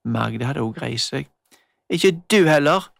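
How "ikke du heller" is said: angry retort. Acted. Magda shouting back in quarrel.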